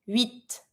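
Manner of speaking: huit with silent H and clear t; sounded final T. Shown as a demonstration. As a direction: huit as "weet", T heard. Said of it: "pronounce the final T".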